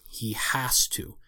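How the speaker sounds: slow breathy whisper. Real, not acted. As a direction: whisper it slowly to yourself. Insisting under his breath.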